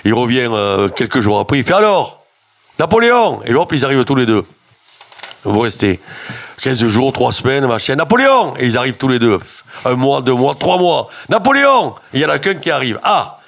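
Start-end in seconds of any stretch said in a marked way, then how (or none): 1.8-2.2 high call of alors; shouted summons down the hall. Retold or imitated. Director calls out to patients. 2.8-3.4 high ringing call of a name. Retold or imitated. Director calls for Napoleon. 8.0-8.5 high ringing call of a name. Retold or imitated. Director calls for Napoleon again. 11.3-12.0 high ringing call of a name. Retold or imitated. Director calls again.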